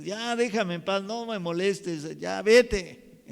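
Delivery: a touch of irritated sick patient; mild mock grumpy. Shown as a demonstration. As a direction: brush off the family crossly. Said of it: "Es muy diferente a decir".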